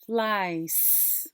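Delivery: FLIES ending in a long S; final sound held. Shown as a demonstration. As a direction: draw out the end of FLIES. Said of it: "let's capture that final sound".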